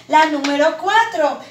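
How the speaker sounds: normal talking voice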